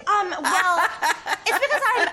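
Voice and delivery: high, stammered excuse to a boss; flustered stalling. Acted. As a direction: stall with a half-laughed excuse. Caught out, stalling her boss.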